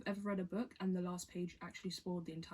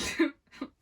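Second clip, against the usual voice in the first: a loud bursting laugh; embarrassed laugh; 6 semitones higher, 11 dB louder than usual. Real, not acted. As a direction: laugh it out, flustered. Embarrassed while reading.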